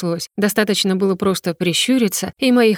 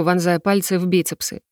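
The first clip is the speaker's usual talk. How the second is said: ordinary delivery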